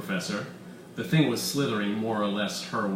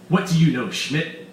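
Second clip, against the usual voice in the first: snapping; 5 semitones higher, 6 dB louder than usual. Acted. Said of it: "snapped Weiss".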